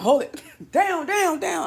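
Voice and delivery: high startled cry, hold it, down, down; jumpy shout at the noise with a laugh; panicked yelp. Retold or imitated. Startled by coffee pot beep, a high panicked yelp. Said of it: "I got nervous".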